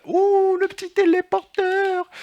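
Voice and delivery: very high squeal of joy; delighted squeal. Real, not acted. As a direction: squeal like you found treasure. Découvre un objet. (cri ravi, très aigu)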